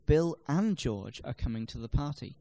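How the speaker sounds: "and" stressed in full between "Bill" and "George"; full, stressed "and", not reduced. Shown as a demonstration. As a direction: punch "and" to include George too. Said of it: "normally unstressed words are stressed for obvious reasons".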